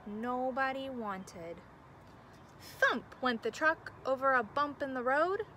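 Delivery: gentle storybook narration; reading to children. A narrator's reading. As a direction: read it like bedtime story time. Reads picture book aloud.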